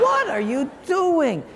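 as if you can't believe it; exasperated disbelief. Retold or imitated. Pastor exasperated at host.

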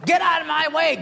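high, loud mock yell at traffic; road rage yell. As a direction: shout at the cars ahead. Road rage driving home.

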